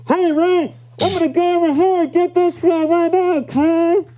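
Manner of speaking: tinny, high, loud like a bad mic; tin can voice. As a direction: squeeze it through a cheap headset. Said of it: "It, it sounds like a tin can".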